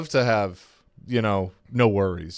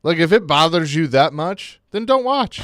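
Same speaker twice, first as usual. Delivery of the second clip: high, loud, fed-up retort; exasperated outburst; 7 semitones higher, 5 dB louder than usual. Real, not acted. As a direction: shout it back at the complainers. Heated reply to a texter.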